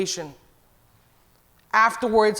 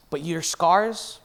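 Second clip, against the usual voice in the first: gentle and slow; 5 semitones lower, 6 dB quieter than usual. Real, not acted. Closing encouragement to the congregation.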